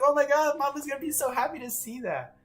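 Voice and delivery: high, delighted fan squeal of a voice; excited gushing. Retold or imitated. Streamer voicing the happy reaction planned for Mama, excited gushing.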